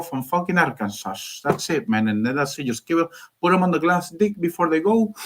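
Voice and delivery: brief your commander like a Cuban street guy; comic Cuban-accented pep talk; Cuban-accented boss. Acted.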